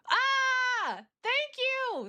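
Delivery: high squeaky thanks; gushing high-pitched thank-you; cute anime streamer. Acted. Thanking donor.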